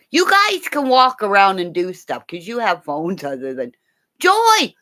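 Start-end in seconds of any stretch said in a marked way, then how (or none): 4.2-4.7 calling a name across the room, higher and louder; high calling shout. Real, not acted. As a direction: shout for Joy who went silent. Calling out to missing Joy.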